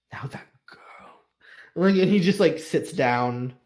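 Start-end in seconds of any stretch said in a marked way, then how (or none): 0.1-1.2 breathy whisper; whispered dread; hushed. Acted. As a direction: whisper it as if it must not be heard. Maester's hushed confession.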